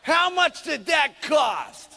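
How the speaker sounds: slow, loud demanding question; husband demands the price from his wife; angry husband shout. Retold or imitated. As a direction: drag it out, loud and furious. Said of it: "He says".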